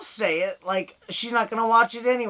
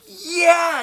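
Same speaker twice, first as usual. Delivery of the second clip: sudden high, loud whoop; excited yell; 10 semitones higher, 6 dB louder than usual. Retold or imitated. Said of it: "looking in the rear view, like".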